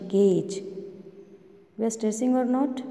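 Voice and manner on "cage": CAGE said firmly with falling pitch; stressed demo word. Shown as a demonstration. Teacher stressing a sound for children, stressed "cage". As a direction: put clear stress on "cage". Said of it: "we are stressing on that word".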